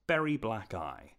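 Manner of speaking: usual speaking voice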